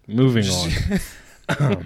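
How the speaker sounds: laughing through the words; fighting off giggles; giggling. Real, not acted. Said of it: "he's got the fucking giggles".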